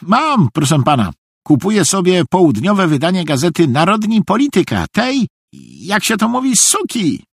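high, eager-to-please voice; high-pitched obedient fool; eager naive simpleton. A narrator's reading. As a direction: confess everything readily in a high voice. Narrator voices naive Szwejk.

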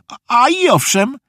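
high, lightly puffed, halting start; puffing with effort. A narrator's reading. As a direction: puff it out mid-squat. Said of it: "wysapał Felek, robiąc dwa przysiady". (lekko zasapany, wysokim głosem)

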